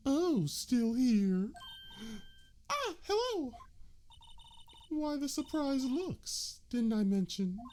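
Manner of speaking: very high, slow sultry woman's voice; high female voice.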